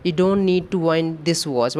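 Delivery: very slow, word by word; slow dictation. Shown as a demonstration. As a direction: give students time to write. Reads the question slowly. (बहुत धीमे लिखवाते हुए)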